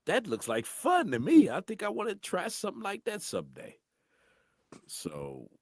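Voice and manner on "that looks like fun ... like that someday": high, slow childlike awe at the cartoon voices; a young boy marvelling, pitched way up; kid voice. A young boy hearing one man did all the cartoon voices, high and amazed. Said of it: "I'm like".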